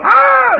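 high-pitched mock holler. Preacher putting on a show for the crowd, hollering. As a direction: imitate a preacher's sudden high, loud cry. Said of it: "So he hollers".

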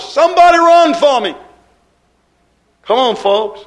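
loud, high pulpit holler. Retold or imitated. Jesus imagined calling the church from the pulpit, hollering. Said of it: "he hollers".

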